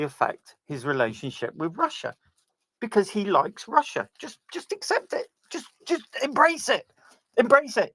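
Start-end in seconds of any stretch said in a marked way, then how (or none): 4.2-7.9 much higher, pleading "just accept it"; mocking high-pitched exasperation; exasperated high mock plea. Real, not acted. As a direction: urge them in a high sing-song to give in. Mocking advice to everyone.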